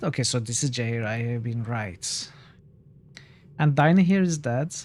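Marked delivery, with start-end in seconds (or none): none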